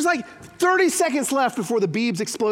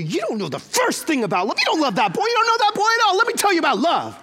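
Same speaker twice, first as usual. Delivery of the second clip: lecturing dad building; 6 semitones higher than usual. Retold or imitated.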